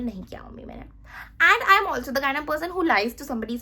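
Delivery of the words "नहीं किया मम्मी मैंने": hushed denial. Retold or imitated. Denying to mom at PTM.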